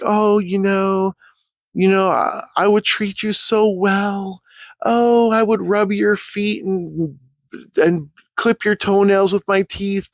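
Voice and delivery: high, slow, drawn-out lovesick pleading; mock simp. Kit wooing the girl, mock lovesick simp voice.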